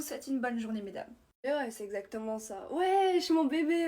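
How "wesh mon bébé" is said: loud, delighted hello to a dear friend; beaming wesh shouted across the room; excited loud greeting. Acted. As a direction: call out to him like a long-lost buddy.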